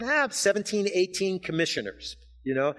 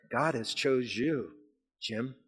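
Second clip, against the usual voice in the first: tender address; 4 semitones lower, 6 dB quieter, slower than usual. Acted. Personal soft address to listener.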